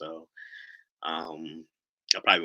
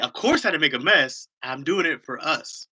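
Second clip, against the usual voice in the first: put-on higher child voice, slowing at the end; little daughter; 6 semitones higher, 5 dB louder than usual. Daughter justifies the mess to dad, insistent little-girl voice. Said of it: "She's like".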